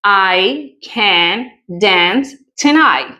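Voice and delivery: slow, carefully spelled out example; slow model sentence. Shown as a demonstration. Teacher modelling the sentence slowly for students. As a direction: read each word out slowly. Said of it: "Miremos un ejemplo".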